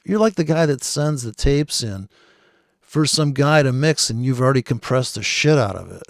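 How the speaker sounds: normal talking voice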